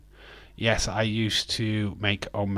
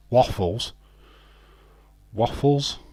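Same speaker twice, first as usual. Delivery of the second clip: loud, questioning disbelief; incredulous; 3 semitones higher, 5 dB louder than usual. Real, not acted. Briton disputing waffles as breakfast, incredulous. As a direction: query it as if it has no place there.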